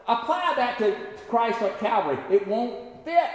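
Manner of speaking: high, pressing sermon delivery; forceful preaching. Real, not acted. Preacher challenging excuses for not forgiving, preaching forcefully. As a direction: drive the challenge home to the congregation.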